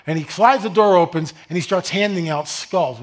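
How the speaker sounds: usual speaking voice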